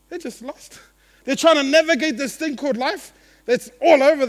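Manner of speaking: loud, high, rising sermon voice; full-force preaching. Acted. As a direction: preach it out to the whole hall.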